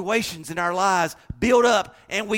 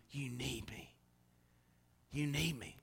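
hushed whisper of God's call; 4 semitones lower, 14 dB quieter than usual. Acted. Preacher whispering God's inner call to the congregation. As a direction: breathe it softly, like a voice inside.

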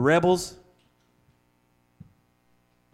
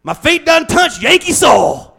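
much higher, louder cartoon cowboy squawk; hammed-up Southern cartoon outburst; Yosemite Sam; 14 semitones higher, 13 dB louder than usual. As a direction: yell it like a panicked cartoon cowboy. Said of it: "He says".

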